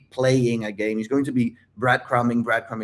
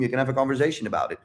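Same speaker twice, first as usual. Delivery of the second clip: usual speaking voice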